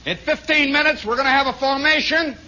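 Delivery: loud, high-pitched sergeant announcement. Acted. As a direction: call it out to the whole barracks. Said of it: "And the sergeant says".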